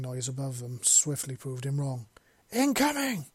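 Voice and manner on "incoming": sudden high scream of warning; screaming a warning as the mortar whistles in. A narrator's reading. Soldier screams to his partner, high and loud. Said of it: "he screamed".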